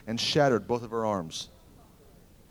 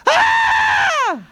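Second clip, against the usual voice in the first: shrill high scream much higher and louder; a woman's joyous shriek; 10 dB louder than usual. Retold or imitated. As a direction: let out a sudden piercing scream. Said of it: "a woman screamed".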